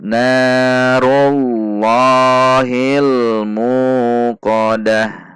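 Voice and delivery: slow, measured recitation with held long vowels; Quran recitation demo. Shown as a demonstration. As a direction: recite evenly, drawing out each long syllable. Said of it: "Kita baca sekali lagi".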